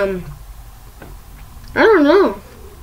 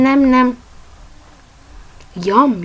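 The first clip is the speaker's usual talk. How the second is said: ordinary delivery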